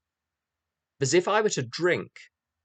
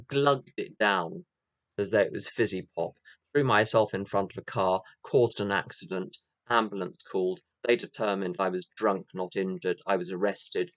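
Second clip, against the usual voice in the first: ordinary delivery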